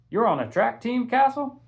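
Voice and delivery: high, loud amazed question; be honestly taken aback; surprised shopkeeper. A narrator's reading.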